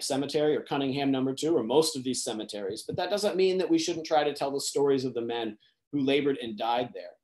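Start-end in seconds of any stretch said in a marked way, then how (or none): none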